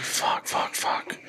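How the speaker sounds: panicked whispered curses under the breath; whispered swearing. Retold or imitated. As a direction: hiss the curses in a voiceless whisper. Retold panic when grandpa asks.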